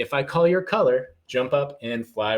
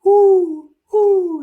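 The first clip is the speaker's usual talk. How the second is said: high, loud hooting owl; 10 dB louder than usual. Pretend. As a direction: hoot like an owl up in a tree. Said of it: "says the owl".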